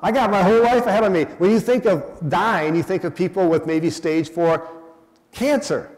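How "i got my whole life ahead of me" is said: high, loud and quick, mock-breezy; imitating a cocky young listener, higher and louder; carefree young person. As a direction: toss it off like a careless youngster. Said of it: "You all in here thinking".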